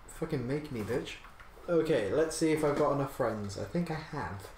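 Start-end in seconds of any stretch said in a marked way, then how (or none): none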